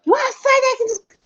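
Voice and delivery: high-pitched, drawn-out mock scream; exploding at the husband, imitated; retold yell. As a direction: act out losing your temper with a shrill yell. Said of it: "gritei. Gritei".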